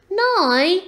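high, loud questioning rise; dismayed question. A narrator's reading. Owlet questions mother's order to go, dismayed and high. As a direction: ask it in high-voiced disbelief.

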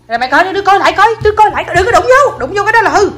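scolding. Real, not acted. Scolding kids about touching.

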